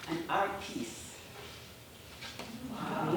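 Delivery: usual speaking voice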